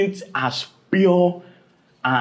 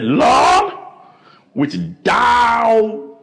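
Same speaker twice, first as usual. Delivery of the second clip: loud, high eager cry to the Lord; eager shout; 7 semitones higher, 4 dB louder, much slower than usual. Acted. Disciples shouting eagerly to their Lord. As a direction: call out like a zealous disciple.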